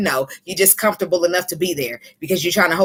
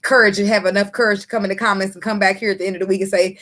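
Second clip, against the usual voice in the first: usual speaking voice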